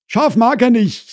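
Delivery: high, loud housewife voice; Frau Krause relaying. Acted. Wife repeats husband's dislike to neighbour, high and loud. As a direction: pass the answer on at high pitch.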